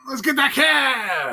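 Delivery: slow, loud, determined; eager shout. Real, not acted. Player eagerly choosing the cab.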